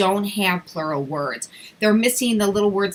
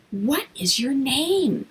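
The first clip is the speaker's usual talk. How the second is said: patient gentle question; 4 semitones higher, slower than usual. Shown as a demonstration. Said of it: "Give them a little think time".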